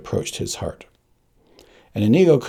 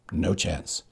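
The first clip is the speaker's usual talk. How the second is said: ordinary delivery, nothing marked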